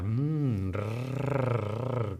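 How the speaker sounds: soft imitated cat purr, rolled rrr. Shown as a demonstration. Teacher imitating her purring cat, softly. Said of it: "hacen este sonido".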